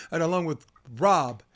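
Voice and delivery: normal talking voice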